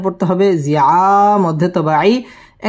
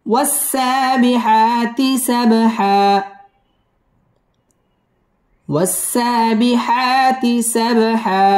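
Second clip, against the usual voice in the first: chanted slowly, long vowels stretched; slow Quranic recitation; 3 semitones higher, slower than usual. Shown as a demonstration. Teacher recites the verse twice for students in slow chant. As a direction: draw out every long alif. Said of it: "wow ঠোঁট গোল করিয়া পড়তে হবে।".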